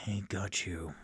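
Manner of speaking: hushed whisper to a hurt friend; whispered reassurance. Acted. Rainier reassures fallen Floon, in a whisper.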